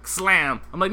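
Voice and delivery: ordinary talk, nothing marked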